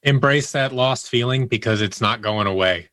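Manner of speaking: normal talking voice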